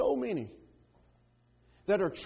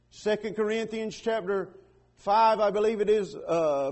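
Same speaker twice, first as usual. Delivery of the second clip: ordinary talk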